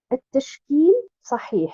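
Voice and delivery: "At-tashkil sahih." said slowly with Arabic sounds; slow classical Arabic delivery of "At-tashkil sahih."; Arabic term. Shown as a demonstration. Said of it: "Les signes diacritiques sont corrects".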